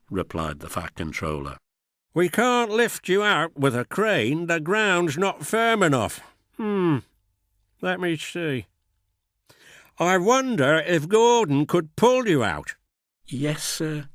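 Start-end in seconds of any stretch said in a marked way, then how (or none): 2.1-12.8 lightly voiced stern boss, pondering; a hint of a gruff official thinking aloud; Fat Controller voice. 13.3-14.1 nervous. Acted. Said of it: "said Thomas nervously".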